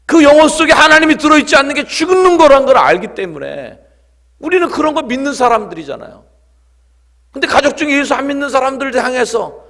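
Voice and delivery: loud, high, urgent sermon; full-force preaching. Real, not acted. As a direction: drive the point home to the congregation. (힘주어 외치는 설교)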